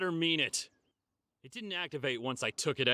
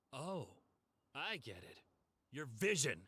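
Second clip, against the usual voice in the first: usual speaking voice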